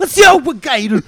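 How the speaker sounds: high, loud hammed-up Australian accent; mock Australian accent. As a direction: bellow it like a rowdy Australian bloke. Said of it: "you know what they sound like?".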